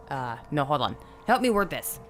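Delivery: ordinary delivery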